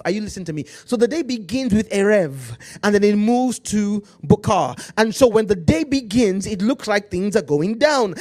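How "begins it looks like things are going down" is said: fiery preaching. Acted. Preaching at full force.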